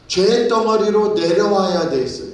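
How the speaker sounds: rising preacher's fervour on 죄; fervent preaching. Acted. Preacher to congregation, 죄 stressed in fervent preaching.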